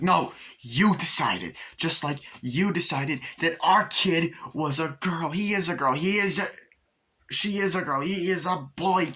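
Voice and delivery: hammed-up indignant insistence; mock argument. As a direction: act out a bickering parent. Acts parents arguing over child.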